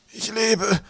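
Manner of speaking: high, strained groan; ailing old professor. A narrator's reading. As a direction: groan it out weakly, pitched high. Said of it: "ächzte der Professor".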